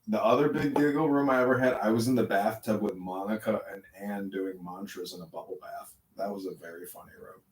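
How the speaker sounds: ordinary delivery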